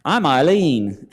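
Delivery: put-on slow, laughing try at a British accent; fumbled British accent, slow and amused. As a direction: break into a laugh mid British impression. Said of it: "I'm trying to get the accent down".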